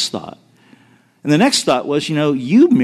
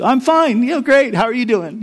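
higher, breezy brush-off of a fake fine; fake cheerful reply; 7 semitones higher than usual. Telling the pastor on the phone he's fine, chirpy and fake. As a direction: chirp it like nothing is wrong. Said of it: "I do what all of us do".

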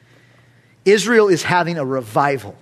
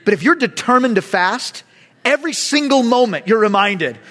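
ordinary talk

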